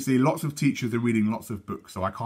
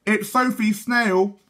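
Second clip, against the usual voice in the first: slow, higher and louder chanted together; chorused twins; 10 semitones higher, 5 dB louder, slower than usual. A narrator's reading. Twins chorus the answer to mum, slow and higher. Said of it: "chorused Matthew and Mark".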